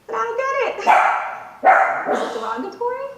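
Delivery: imitating a whiny-puzzled sitcom star; Jerry. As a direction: pitch it up and stretch the puzzled question. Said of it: "And Jerry says".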